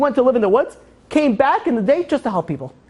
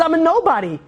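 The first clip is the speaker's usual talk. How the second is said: mild, higher-pitched despair; anguished outburst; 8 semitones higher than usual. Retold or imitated. King alone by the lake, anguished outburst. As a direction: cry it out in mock anguish.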